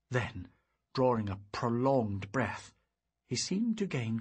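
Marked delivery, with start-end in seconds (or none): none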